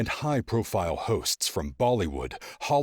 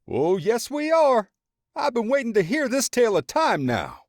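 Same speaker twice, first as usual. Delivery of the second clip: very high, loud gleeful friend voice; eager grinning friend; 7 dB louder than usual. A narrator's reading. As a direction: rub your hands, can't wait for the story. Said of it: "grinning and rubbing his hands together".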